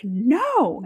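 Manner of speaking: loud, high refusal; firm no. Acted. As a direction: shut him down hard. Refusing a kiss.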